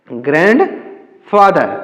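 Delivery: Grandfather drawn out in two parts, "grand", then "father"; slow word demo. Shown as a demonstration. Teacher reads the new word for learners, slow grandfather. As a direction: say grandfather slowly, pausing in the middle.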